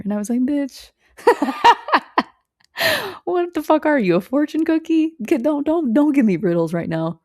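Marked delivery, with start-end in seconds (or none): 0.4-0.9 higher, mock-exasperated comeback; incredulous sass. Exasperated retort to riddle. 1.2-2.2 high burst of laughter. Real, not acted. Laugh inside retort. 3.2-7.2 higher, mock-exasperated comeback; incredulous sass. Exasperated retort to riddle.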